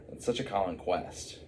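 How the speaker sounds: usual speaking voice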